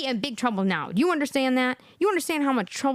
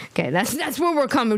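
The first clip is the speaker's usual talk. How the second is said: ordinary delivery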